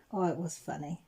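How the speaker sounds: usual speaking voice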